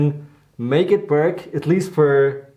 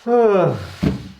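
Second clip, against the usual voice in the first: long tired sigh; weary sigh; 4 semitones higher than usual. Real, not acted. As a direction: sigh out the fatigue. Tired of sanding, needs break.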